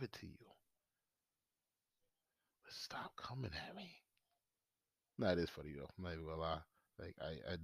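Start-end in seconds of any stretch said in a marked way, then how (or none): 0.0-4.0 whisper. Real, not acted. Whispered plea to friend.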